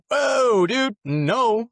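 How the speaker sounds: high, loud "whoa, dude, no" of disbelief; retold shout of alarmed surprise; shocked outburst. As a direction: blurt it out as if you can't believe what you just read. Shocked reaction to reading the Catechism.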